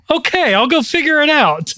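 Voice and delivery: high, lilting agreeable reply; mock compliance. Girl sent to go figure it out, imitated high and compliant. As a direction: imitate someone agreeing too easily, pitched well up.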